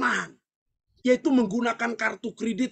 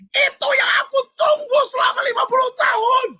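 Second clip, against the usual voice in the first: high, loud furious wife, half-laughing, half-crying; wife lashing out after fifty years, much higher and louder; angry wife; 8 semitones higher, 5 dB louder than usual. Retold or imitated. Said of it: "Istrinya lebih marah".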